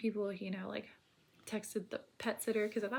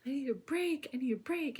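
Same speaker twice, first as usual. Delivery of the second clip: begging for a break, voice pitched up; retold sobbing plea; 5 semitones higher than usual. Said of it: "like, sobbing".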